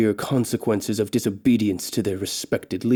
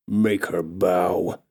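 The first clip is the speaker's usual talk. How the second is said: slow, low orc order; low and heavy, a brute's command; orc chief command; 4 semitones lower, much slower than usual. Acted. Orc chief ordering his servants, slow and low. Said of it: "the orc chief commands".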